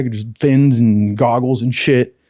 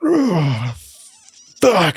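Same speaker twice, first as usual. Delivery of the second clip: pained groan; 6 semitones higher than usual. Retold or imitated. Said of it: "he was just like".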